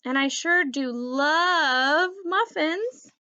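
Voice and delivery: slow, drawn-out begging whine. A narrator's reading. Puppy begging for muffins, slow pitiful whine. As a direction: stretch it out, pitiful. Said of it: "I whine".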